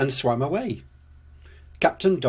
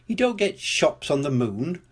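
plain reading voice

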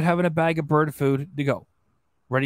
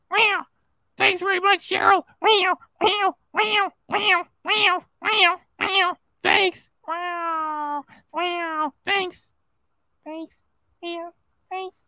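high squeaky cat voice with meows; Super Chat Cat; 11 semitones higher, much slower than usual. Acted. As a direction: meow and thank them like a cartoon cat, far higher. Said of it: "well, look, I'm a cat".

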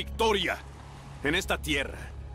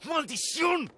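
angry curse; slower than usual. Acted. Prince curses troops withdrawn.

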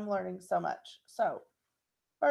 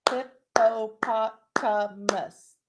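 "Hippopotamus" slow and louder, syllable by syllable; clapped slowly; 4 dB louder, much slower than usual. Shown as a demonstration. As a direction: clap out "Hippopotamus" beat by beat. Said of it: "Let's clap out hippopotamus".

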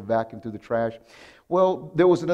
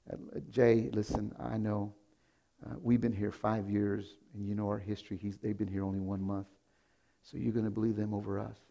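softer, restrained politeness; forced calm; 5 semitones lower, 9 dB quieter than usual. Retold or imitated. Pastor calmly answering the accusing owner, soft and restrained. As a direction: swallow the anger and speak low. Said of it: "I forced a smile".

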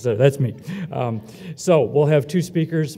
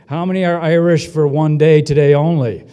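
louder, calling out over the room; loud question to the crowd; 3 semitones higher, 8 dB louder than usual. Real, not acted. Host polls the audience at the ceremony, loud playful call. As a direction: raise the voice to poll the hall. Asking crowd for show of hands.

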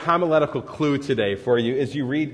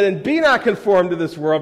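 fired up; 4 semitones higher, 7 dB louder than usual. Real, not acted. Recalls being carried away preaching.

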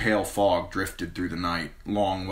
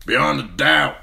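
higher, louder rough sellsword; 8 semitones higher, 8 dB louder than usual. A narrator's reading. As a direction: play the cocky sellsword.

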